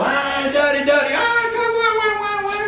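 put-on high, whining baby wail; crying baby. Baby son wailing at his father over his food. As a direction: wail like a fussy infant for daddy.

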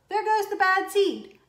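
high loud gossipy call. Acted. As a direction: shout it to the others. Other seeds call out.

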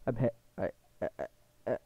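usual speaking voice

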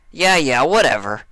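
high, loud brush-off; impatient Dash. A narrator's reading. Dash cutting off the registrar, loud and impatient. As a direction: wave him off, bored and pushy.

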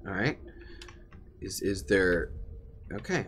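ordinary talk

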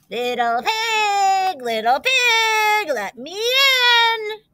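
high, slow, drawn-out wolf call; growling wolf. A narrator's reading. Hungrier wolf at the second door, slow high call. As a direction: drag out every word at the door. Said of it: "he growled".

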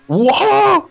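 aigu et fort, vraiment bluffé; lâche un wouah ébahi; exclamation de surprise. Real, not acted. Joueur stupéfait par l'écart. (voix stupéfaite)

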